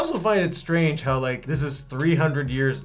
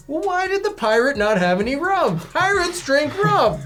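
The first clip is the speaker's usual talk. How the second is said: whiny complaining viewer; 12 semitones higher, 5 dB louder than usual. Retold or imitated. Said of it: "we'd be complaining".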